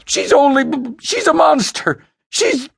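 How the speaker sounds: high, stammering screams, losing control; frantic scream. Acted. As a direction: choke on the words in rage. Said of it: "screamed wordlessly".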